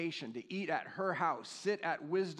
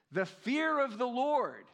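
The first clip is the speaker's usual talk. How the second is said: proclaimed verse; 7 semitones higher, 5 dB louder, slower than usual. Acted.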